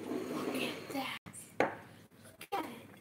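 hushed, breathy whisper over food. Real, not acted. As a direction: whisper it like a secret treat.

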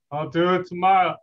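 put-on sitcom husband's brush-off; cartoon husband. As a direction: answer back as the nagged cartoon husband. Answers Marge as her husband.

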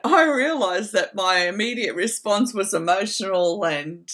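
normal talking voice

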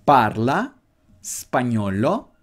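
slow, careful parla spagnolo, spagnolo drawn out; slow teaching model; slow model phrase. Shown as a demonstration. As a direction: say spagnolo slowly for learners. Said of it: "Así que esto se diría".

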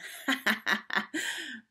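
high, mocking acted laugh; teasing run of giggles; departing laugh. A narrator's reading. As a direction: laugh scornfully on the way out. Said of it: "She walked out of his room laughing".

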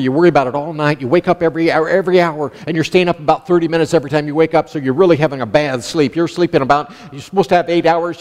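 ordinary talk, nothing marked

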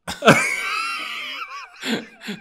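real high-pitched cackling laugh; high helpless laughter. Real, not acted. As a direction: burst out laughing high and long. Laughing at the bad impression.

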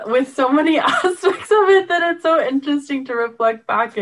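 words bubbling with real laughter; laughing. Real, not acted. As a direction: laugh through it, amused at the memories. Laughing while reflecting on show.

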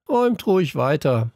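lightly acted gang leader calling; called out. A narrator's reading. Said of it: "rief der Anführer".

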